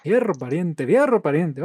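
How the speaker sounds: put-on loud, high northern Mexican accent. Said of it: "dame una frase norteña típica".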